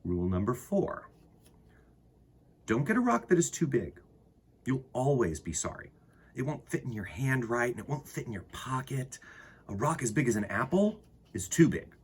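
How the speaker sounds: put-on gentle read-aloud to children; storybook reading. Reads picture book aloud.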